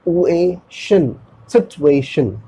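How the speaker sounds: सिचुएशन slowly in parts, "sit - u-ay - shun"; broken into syllables. Shown as a demonstration. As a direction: model सिचुएशन piece by piece, then joined. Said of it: "बोलना है". (सिचुएशन को टुकड़ों में, ट के साथ)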